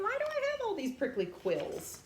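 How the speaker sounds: high, puzzled little voice; wondering porcupine. Acted. Young porcupine wondering to himself, puzzled and high. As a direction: ask it like a lonely young porcupine. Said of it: "So he's wondering".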